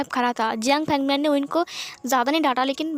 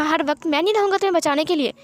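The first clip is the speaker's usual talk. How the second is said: angry scolding; 6 semitones higher than usual. A narrator's reading. Wing scolds Wang Ji for never fighting back, angrily. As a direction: snap at him, fed up with his weakness. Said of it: "wing ने उसे बहुत डांटा".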